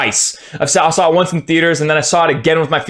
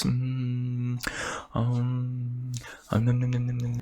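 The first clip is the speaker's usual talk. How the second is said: murmured nom noises; 3 semitones lower, 14 dB quieter than usual. Real, not acted. Soothing self after rant.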